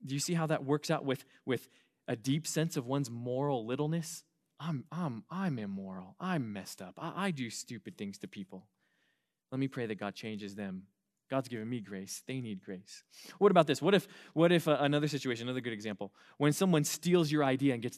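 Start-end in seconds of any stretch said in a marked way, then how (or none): 4.6-13.0 humble confession. Retold or imitated.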